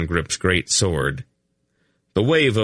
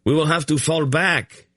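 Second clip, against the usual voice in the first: urgent raised call; shouted warning; 8 semitones higher than usual. A narrator's reading. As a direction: shout over the battle noise. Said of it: "he shouted".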